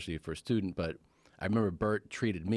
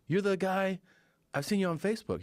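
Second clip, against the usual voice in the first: high-pitched, raised-voice fan call; imitated excited fan; 11 semitones higher than usual. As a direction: mimic someone spotting a celebrity. Fans calling out to recognised comedian.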